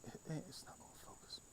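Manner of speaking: frustrated hushed whisper. Real, not acted. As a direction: whisper in annoyance. Struggling with camera, whispering.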